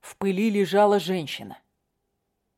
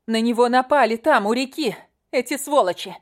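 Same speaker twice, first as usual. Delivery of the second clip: angry shouting; 5 semitones higher, 4 dB louder than usual. Acted. Katya shouts the attackers' story.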